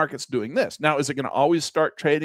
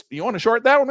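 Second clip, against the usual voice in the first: higher, louder eager question; eager trader asking; 10 semitones higher, 5 dB louder than usual. Acted. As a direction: play the hopeful customer, pitched up. Acted trader-broker exchange.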